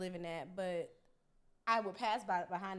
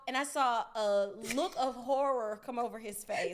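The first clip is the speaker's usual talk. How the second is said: ordinary delivery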